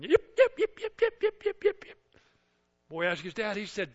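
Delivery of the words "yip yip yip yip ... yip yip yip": very high, shrill yaps; high little-dog yip-yip; yipping little dog. Retold or imitated. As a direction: yap like a tiny dog. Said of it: "Little dog going".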